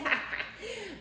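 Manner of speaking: high burst of laughter; real laugh. Real, not acted. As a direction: laugh out at the pettiness. Laugh after the quote.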